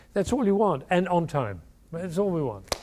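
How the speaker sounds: ordinary talk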